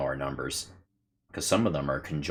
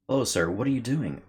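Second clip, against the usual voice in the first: character voice; 7 semitones higher, 4 dB louder than usual. Acted. Voicing the game heroine.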